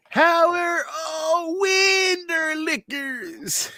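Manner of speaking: very slow, high drawn-out greeting; drawn-out comment read. Acted. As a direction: stretch it out in a high singsong. Reading a chat comment.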